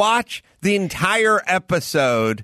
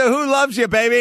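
excited Kojak catchphrase; 8 semitones higher than usual. Retold or imitated. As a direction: belt it out like a fan doing the line. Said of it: "Who does the".

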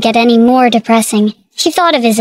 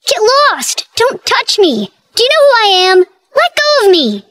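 scream; 9 semitones higher than usual. Acted. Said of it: "he screamed".

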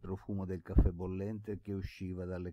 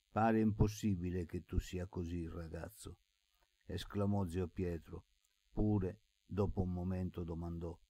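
plain reading voice

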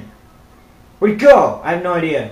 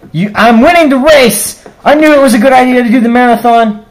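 boastful shouting; 3 semitones higher, 7 dB louder than usual. Acted. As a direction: crow about winning the race. Boasting while racing.

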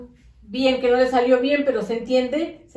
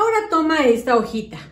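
put-on talking to young kids. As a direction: coax the children along through the worksheet. Teacher addresses small children.

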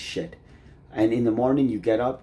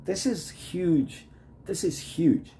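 emphatic exclamation; 8 semitones higher, slower than usual. Acted. Stressing a saying's importance.

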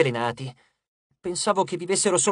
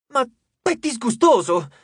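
disgusted; 7 semitones higher, 5 dB louder than usual. Acted. Frank reacts to stolen relics.

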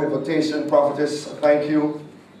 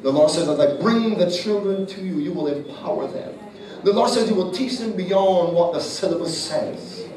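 higher, louder preacher's cry building through the word; fervent prophecy shouted over the congregation; preaching at full force; 6 semitones higher, 4 dB louder than usual. Acted. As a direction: proclaim it over her, rising to a crescendo.

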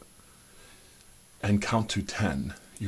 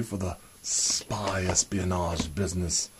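normal talking voice

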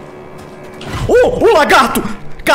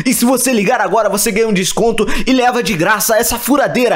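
higher, pushy infomercial pitch; TV ad announcer; 11 semitones higher than usual. Acted. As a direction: sell it like a late-night infomercial. Parody TV shopping ad.

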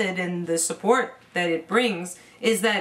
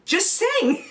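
laughing; 12 semitones higher than usual. Real, not acted. Laughing while coaching.

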